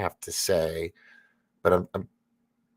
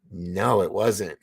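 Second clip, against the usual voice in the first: usual speaking voice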